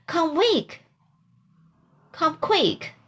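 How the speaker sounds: high-pitched acted urging; urgent call. A narrator's reading. Sid hurries Aunt Polly upstairs, urgent. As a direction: urge them on, voice jumping high.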